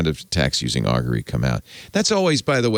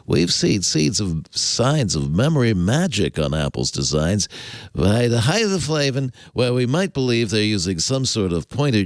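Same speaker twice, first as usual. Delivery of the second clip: nerdy voice. Acted. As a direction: read it like a proud tech geek. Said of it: "I gotta do this in a more nerdy voice".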